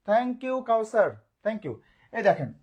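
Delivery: ordinary talk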